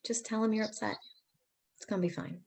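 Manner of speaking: normal talking voice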